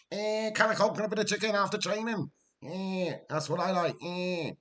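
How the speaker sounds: high-pitched footballer impression; high put-on voice with little "eh" noises; Carragher. Streamer showing a footballer's verbal habit, high comic impression. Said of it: "That's what Carragher does".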